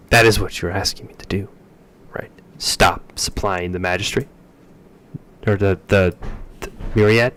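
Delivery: furious loud official; loud angry official. Acted. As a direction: hammer the warning home loudly. Official shouting at diplomats.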